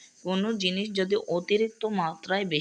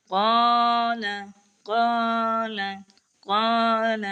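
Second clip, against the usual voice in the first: loud recitation; 4 semitones higher, 6 dB louder, much slower than usual. Shown as a demonstration. Teacher recites verse loudly.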